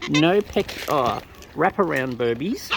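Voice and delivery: ordinary delivery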